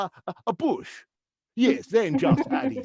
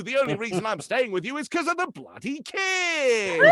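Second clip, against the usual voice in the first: higher, cheery advert voice, shouting at the end; ad-voice row; 6 semitones higher, 3 dB louder than usual. Retold or imitated. Husband answers wife's nagging in bright advert voice, shouting. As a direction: deliver the insults like a toy ad. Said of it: "And I say".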